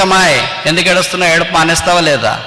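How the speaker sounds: quick, curt questioning of someone crying; mock an unsympathetic demand to stop crying; acted impatience, rattled off fast; impatient scolding. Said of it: "అలాగ అనలేదు".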